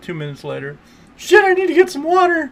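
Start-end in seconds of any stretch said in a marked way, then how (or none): 1.2-2.5 high, loud sudden alarm; panicked shout. Retold or imitated. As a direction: yell as if suddenly starving in game. Friend suddenly realizing she's dying.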